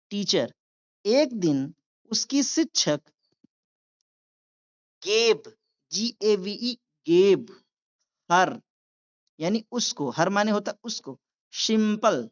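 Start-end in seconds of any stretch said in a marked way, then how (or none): none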